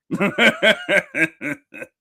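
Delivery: high, helpless laughter; giggling fit. Real, not acted. Streamer apologises for his slip, cracking up laughing. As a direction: crack up at your own slip.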